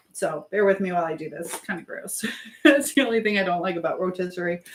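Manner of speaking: ordinary talk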